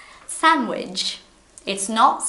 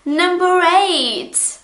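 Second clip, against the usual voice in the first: announcer call; 10 semitones higher, 5 dB louder, much slower than usual. Real, not acted. Announcing list item.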